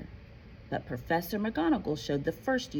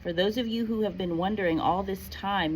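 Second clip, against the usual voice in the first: ordinary delivery, nothing marked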